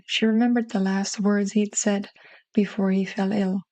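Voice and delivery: ordinary delivery, nothing marked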